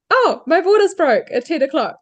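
re-live the jolt of realisation; mild startled announcement; retold surprise. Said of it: "To then".

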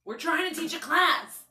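"Yo" shouted, then a slow, loud scold; aggressive yell. Retold or imitated. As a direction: yell it like scolding a bad dog. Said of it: "I yelled at them like I would yell at, like, a bad dog".

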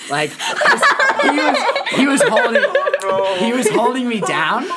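cracking up mid-sentence; laughing. Real, not acted. Recounting the doctor holding him down, laughing.

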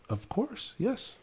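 a lightly voiced manager promising the pineapple; imitating the manager. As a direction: play the polite manager stalling. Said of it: "He goes".

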